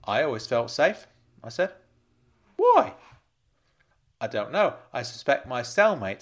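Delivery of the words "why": very high, loud one-word challenge; disbelieving question. Acted. Uncle demands a reason from Frank, high and loud. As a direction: burst out with it, pitched way up.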